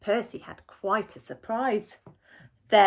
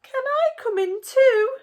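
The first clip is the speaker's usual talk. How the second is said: high, loud fox voice; pleading fox; 12 dB louder than usual. A narrator's reading. Fox asking Percy to come in, high and loud. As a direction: beg to come in like a hungry fox. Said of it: "he asked".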